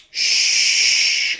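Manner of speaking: long shushing exhale through pursed lips. Shown as a demonstration. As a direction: blow the air out through tight lips. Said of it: "So it's gonna look and sound like this".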